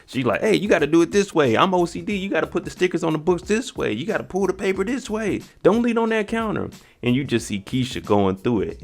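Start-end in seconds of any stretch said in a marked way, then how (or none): 0.4-6.7 high-pitched, fussy imitation; nagging manager. Picky manager correcting Keisha at work, high nagging voice. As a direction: rattle off orders, pitch climbing to the last.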